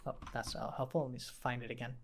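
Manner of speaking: very quiet aside while searching; low-volume mumble to yourself; muttering to self. Real, not acted.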